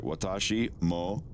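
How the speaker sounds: ordinary talk, nothing marked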